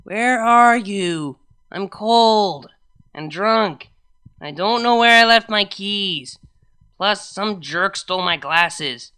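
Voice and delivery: put-on high, loud, slow drunken rambling; drunk party girl. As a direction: slur it like a tipsy friend on the phone. Drunk friend's phone message.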